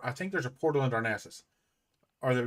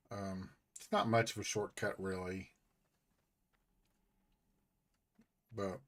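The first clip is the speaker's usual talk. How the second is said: slightly slurred from sore tongue; 5 semitones lower, 9 dB quieter than usual. Real, not acted. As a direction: talk round a sore tongue. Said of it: "I keep talking a little funny".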